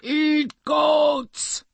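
high, shouted monster demand. Acted. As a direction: bellow it like a hungry monster. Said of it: "said the monster".

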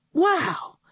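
high, thrilled wow; wide-eyed kid's wow; amazed wow. A narrator's reading. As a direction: gasp it out in wonder. Kid amazed by robot.

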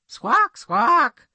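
high double squawk; bird squawk. Acted. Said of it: "said the bird".

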